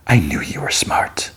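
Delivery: slow, unvoiced whisper. Mysterious man closes the deal.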